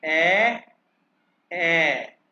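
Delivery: ए as a long German ä, loud; German umlaut A. Shown as a demonstration. Teacher asking for close listening, ए as German ä. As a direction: hold ए out firmly as the umlauted A. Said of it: "लेकिन pronounce कैसे करते हैं".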